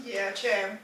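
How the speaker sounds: usual speaking voice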